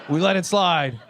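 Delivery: usual speaking voice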